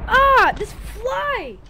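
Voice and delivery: high, slow drawn-out yell; frustrated yell. Real, not acted. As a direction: drag out a frustrated shout. Fly attacking, panicked yelling.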